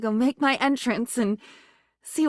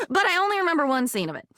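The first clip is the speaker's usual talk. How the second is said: ordinary talk, nothing marked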